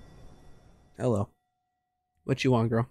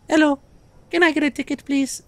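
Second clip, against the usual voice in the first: much higher squeaky customer; 13 semitones higher than usual. Acted. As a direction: pitch it way up as a customer. Voicing a customer.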